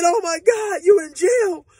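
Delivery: imitating a hysterical girlfriend; crying and hollering. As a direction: wail it in disbelief. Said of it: "she crying and hollering".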